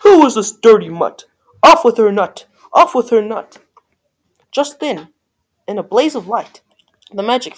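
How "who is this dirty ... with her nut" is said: loud royal outburst; angry cry. A narrator's reading. Prince ordering Cindy's beheading, crying out loudly. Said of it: "The prince cried".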